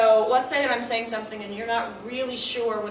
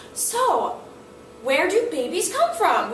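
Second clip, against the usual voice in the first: put-on curious child asking; 5 semitones higher, 4 dB louder, slower than usual. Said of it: "four-year-old daughter or son comes up".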